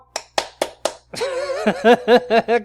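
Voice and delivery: loud high cackling laugh; loud cackle. Real, not acted. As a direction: cackle at the joke. Laughs after honking.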